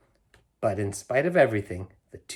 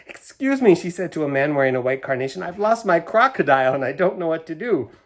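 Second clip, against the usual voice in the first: high, loud, anxious plea; a frantic lady who lost her crocodile; worried woman asking for help, higher and louder; upset; 5 semitones higher, 6 dB louder than usual. A narrator's reading. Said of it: "Missus Prim grew more and more upset".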